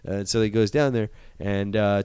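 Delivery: ordinary talk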